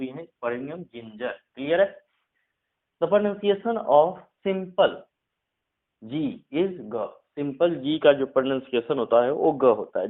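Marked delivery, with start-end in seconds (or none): 3.0-7.1 slow, deliberate rule dictation. Shown as a demonstration. Teacher to Hindi students, dictating the rule slowly. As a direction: spell out the rule word by word for students.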